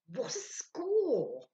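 high, loud, very slow gloat; triumphant. A narrator's reading. Sylvia delighting in the plan, high and slow. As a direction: savour the victory.

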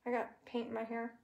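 ordinary delivery

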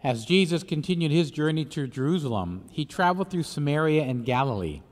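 solemn, measured liturgical reading; Gospel reading. Acted. Priest proclaims the Gospel.

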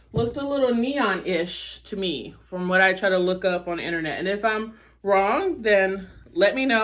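ordinary talk, nothing marked

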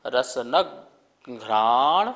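रसनक घ्राण drawn out, the घ्राण aspirated and stretched; slow careful recitation. Shown as a demonstration. Teacher drilling students on correct घ्राण, slow and firm. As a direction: sound each syllable of घ्राण slowly and firmly. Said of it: "के साथ थोड़ा सा होगा तो और भी शुद्ध".

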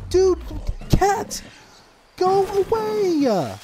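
very high, loud, drawn-out shouting; panicked yell. Real, not acted. Player yelling at a big cat, panicked. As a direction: scream at it to back off.

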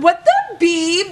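shocked yell. Retold or imitated. Yelling at the thief grabbing from her bag, shocked. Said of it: "That's what I said so loud".

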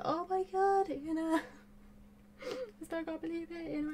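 high squeal dissolving into laughter; giddy disbelief. Real, not acted. YouTuber thanking viewers as she signs off, giddy disbelief. Overcome again while signing off.

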